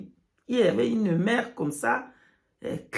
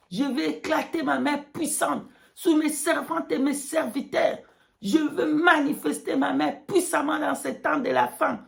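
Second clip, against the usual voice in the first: higher, ringing prophetic voice; prophetic proclamation; 5 semitones higher than usual. Pretend. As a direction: declare it like prophecy. God's message quoted.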